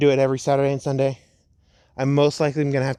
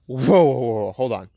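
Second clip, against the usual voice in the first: shocked disbelief. Acted. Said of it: "And she goes".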